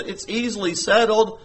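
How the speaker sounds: normal talking voice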